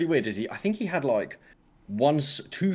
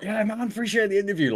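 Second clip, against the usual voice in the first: high, put-on druggie voice; off the fent; 9 semitones higher than usual. Guest off the fent greets interviewer, mock druggie voice. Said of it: "like, off the fent".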